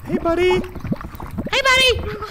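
high drawn-out sing-song greeting; high silly voice. Pretend. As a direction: stretch it out in a squeaky voice.

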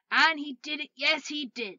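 high, loud triumphant telling; gleeful, emphatic storyteller; exultant narration. A narrator's reading. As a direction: crow over the success.

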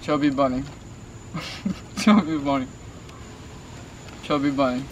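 cheeks stuffed with marshmallows; talking through a mouthful of marshmallows; mouth full of marshmallows. Real, not acted. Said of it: "try to pronounce Chubby Bunny as best as he can".

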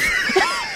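high shrieked laughter; shrieking laugh. Real, not acted. Reacting to the joke about Shane with a shriek.

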